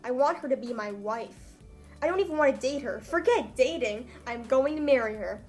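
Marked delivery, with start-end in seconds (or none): none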